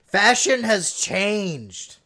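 slow, as if gripping a cigar; cigar in mouth. Pretend. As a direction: talk around a cigar. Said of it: "I can't believe I had a cigar in my mouth".

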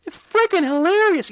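very high put-on voice; squeaky, hammed-up gushing; mock squeal. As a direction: pitch it way up, mockingly. Mock promo quote, pitched far up.

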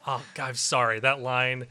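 ordinary delivery

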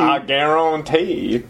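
slow, drawn-out Bayou accent, falling at the end; New Orleans accent. Shown as a demonstration. Co-host gives their best New Orleans accent, slow Bayou drawl. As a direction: stretch it out like a Louisiana Cajun. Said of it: "as good as the New Orleans accent".

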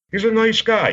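high-pitched imitation of the wrestler; Lord Alfred Hayes. Wrestler vouching for a colleague, imitated in a high voice. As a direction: put on his high voice to vouch for someone. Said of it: "He's like".

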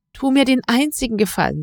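impatient sharp plea. Acted. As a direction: snap it out quickly. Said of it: "sagte Katharina scharf".

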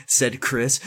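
usual speaking voice

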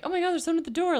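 a touch of breathless excitement at the knock; all excited. Retold or imitated. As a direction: relay the friend's eager squeal, half-mocking. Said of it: "like, all excited".

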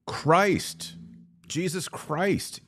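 normal talking voice

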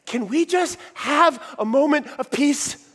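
high, slow mock plea; a hint of the weary wife begging; pleading wife. Wife asking her angry husband for calm, mock plea. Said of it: "she just says".

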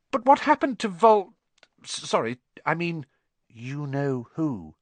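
high halting nervous question; nervous stammer. A narrator's reading. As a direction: trip over the forbidden name. Harry's nervous stumbling question.

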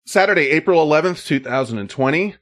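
upbeat show intro read; announcer voice. Acted. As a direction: announce it like a host.

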